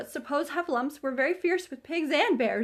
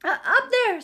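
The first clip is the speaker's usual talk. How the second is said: scared Piglet; 10 semitones higher, 7 dB louder than usual. A narrator's reading. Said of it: "said Piglet".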